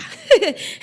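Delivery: loud bright burst of laughter; sudden loud laugh; real laugh. Real, not acted.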